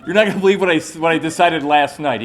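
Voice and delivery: bursting to tell him; can't wait to share it; excited. Retold or imitated. Excited news to the coach.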